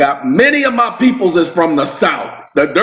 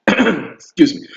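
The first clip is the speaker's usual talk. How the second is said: fake throat clearing; 6 semitones higher, slower than usual. Real, not acted. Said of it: "Got something caught in my throat".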